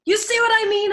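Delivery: a shrill I-told-you-so; Clevenger insisting. Acted.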